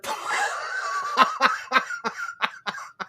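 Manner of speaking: long high-pitched laughing fit; derisive laughter. Real, not acted. Streamer laughing at someone's claim to be leader, high derisive laugh. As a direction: burst into high, sustained laughter.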